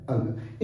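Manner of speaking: "अंग" whispered softly as the correct sound; whispered model answer. Shown as a demonstration. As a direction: quietly give the answer "अंग". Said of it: "क्या है?". (फुसफुसाकर सही उच्चारण "अंग")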